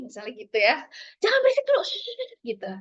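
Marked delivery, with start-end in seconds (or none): 1.2-1.8 high, loud acted telling-off; disapproving parent. Working mother telling her noisy child to hush, high, loud scolding. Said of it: "ada lagi disapproving parent".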